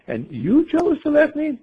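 very high, slow disbelieving question; acted-out astonishment, pitch leaping way up; incredulous. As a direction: stretch it out in mock disbelief. Voicing swimmer incredulous at bird.

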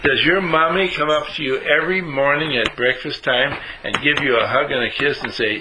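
ordinary talk, nothing marked

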